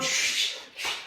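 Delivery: long hissed shush at a dog; forceful "shh"; sharp shushing. Real, not acted. Correcting the jumping dog live.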